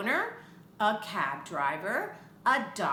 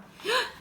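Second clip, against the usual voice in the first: high sharp theatrical gasp; mock gasp; 13 semitones higher than usual. Storyteller gasping at the vendor at the door. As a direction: gasp big for the kids.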